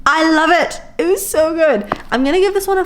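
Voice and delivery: high-pitched, excited gush. Real, not acted. Reader giving viewers a four star verdict, excited high gushing.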